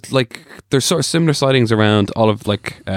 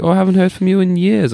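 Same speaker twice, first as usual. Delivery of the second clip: imitating friend; 9 semitones higher than usual. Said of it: "she's like".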